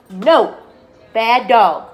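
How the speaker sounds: loud sharp scolding of the dog. Real, not acted. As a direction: tell the dog off firmly.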